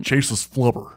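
hint of a gruff, stiff-jawed actor impression; lightly mocked gruff movie-villain voice; Ted Levine. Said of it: "that's kinda how he talks".

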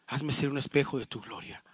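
low, soft, in one unbroken phrase; quiet reflective repeat. Real, not acted. Pastor repeating the prayer's line for reflection, quiet and low. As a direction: softly restate it without drawing it out.

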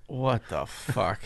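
slow, dragged-out mock disbelief; stunned disbelief. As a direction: stretch it out like you're floored. Vendors stunned at the news.

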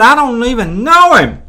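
high, loud flat-out denial; indignant denial. A narrator's reading. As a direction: act out an accused man's outraged protest. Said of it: "But Peter denied it".

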